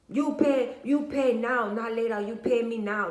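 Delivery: imitating a pushy demand, loud and high; salon worker with attitude. Nail salon worker to a customer suspected of stealing, mock pushy attitude. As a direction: insist loudly, as if the customer might steal. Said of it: "lady got an attitude with your ass".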